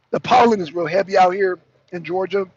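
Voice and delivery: ordinary talk, nothing marked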